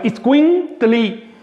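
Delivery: "itzcuin tli" drawn out with a break before tli; itzcuin tli split slowly. Shown as a demonstration. Teacher showing the tli suffix to students, slow and careful. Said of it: "aplicamos el sufijo tli".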